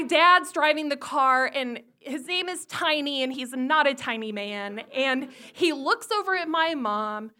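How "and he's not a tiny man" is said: ordinary talk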